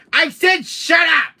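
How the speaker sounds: high, loud, slow yell; exasperated full-voice yell; angry shout. Acted. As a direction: shout them down, leaning on each word. Yelling at the voice to stop.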